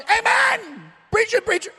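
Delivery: high, loud call from the back row; shouting amen. Retold or imitated. Imitating the gentleman yelling amen at the preacher, shouting. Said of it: "He's shouting".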